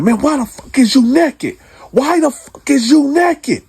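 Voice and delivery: loud, high angry yelling; retold yell. Cellmate caught naked at night, furious loud yell. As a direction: yell it at him like you just woke to a nightmare. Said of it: "And now I'm yelling at this time".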